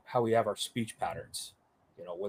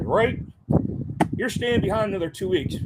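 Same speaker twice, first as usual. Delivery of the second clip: higher, hand-on-shoulder captain; captain telling him he stays behind, voiced higher; mock captain; 8 semitones higher, 4 dB louder than usual. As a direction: break the news breezily.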